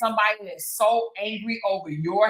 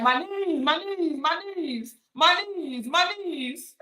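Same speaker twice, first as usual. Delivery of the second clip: higher whiny mock groan, "my knees"; mock complaining; 5 semitones higher than usual. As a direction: imitate someone moaning about sore knees, higher. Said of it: "They're like".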